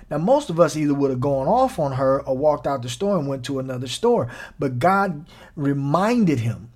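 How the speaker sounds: ordinary talk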